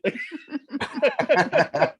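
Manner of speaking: high bursting laugh. Real, not acted. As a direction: laugh it out after the joke. Laughing at midget remark.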